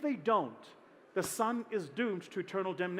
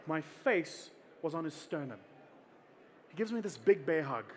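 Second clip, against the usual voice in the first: ordinary delivery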